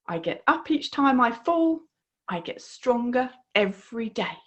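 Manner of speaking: usual speaking voice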